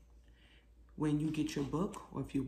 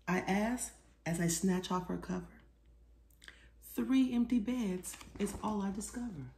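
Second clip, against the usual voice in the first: lively picture-book read-aloud; reading to children; 4 semitones higher than usual. A narrator's reading. Author reading her book aloud to children, lively. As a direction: read it like storytime for little kids.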